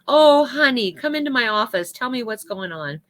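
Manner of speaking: a high, loud "Oh, honey" easing into a gentler tone; sweet motherly concern. Retold or imitated. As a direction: call her in like a caring grandmother. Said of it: "Marjorie's like".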